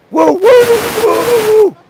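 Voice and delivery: high, drawn-out woo woo; train whistle hoot. Pretend. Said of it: "That was my impression of a train whistle".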